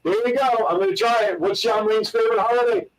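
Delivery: ordinary talk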